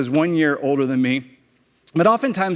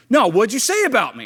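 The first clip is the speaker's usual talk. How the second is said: higher-pitched mock demand; confrontational bully; 7 semitones higher, 4 dB louder than usual. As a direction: imitate a bully pressing for an answer. Retold bully pressing him.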